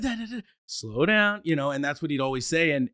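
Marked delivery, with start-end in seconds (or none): 0.0-1.4 higher, slow, eager calls of Dad; a child rushing to speak, then slowing down; excited kid, then slowing. Retold or imitated. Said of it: "And I'd be like".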